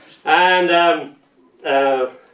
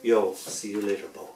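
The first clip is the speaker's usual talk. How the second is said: softer, lower farewell; soft little prayer; 5 semitones lower, 8 dB quieter than usual. Real, not acted. Saying goodbye to the buried skeleton, softly like a prayer. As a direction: say goodbye gently under your breath. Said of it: "I smile and whisper a little prayer".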